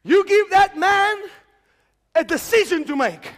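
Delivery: slow, loud shout at heaven; retold shout to God. Preacher retelling his prayer on the pavement, loud slow shout. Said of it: "I shouted".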